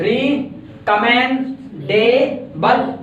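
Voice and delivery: री, commend, डे, बल with clear gaps; syllables listed separately. Shown as a demonstration. Teacher answering a student's doubt, री, commend, डे, बल set apart. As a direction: say री, commend, डे, बल as four separate pieces. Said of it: "four syllables हो गए ना".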